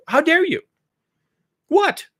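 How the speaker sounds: very high, loud indignation; mock outrage. Imagined critic to the Chinese, mock outrage. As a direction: burst out in hammed-up disbelief.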